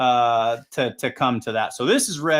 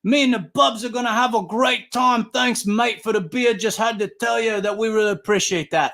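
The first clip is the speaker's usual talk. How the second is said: imitating South African accent; 8 semitones higher than usual. Fan thanking the announcer for beer, in a mock South African accent. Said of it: "And I'm hearing this accent".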